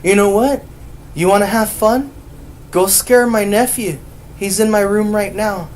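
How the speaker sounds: loud, high, goading retelling; acting out an exasperated dare; exasperated egging-on. Retells egging Mary on to scare nephew.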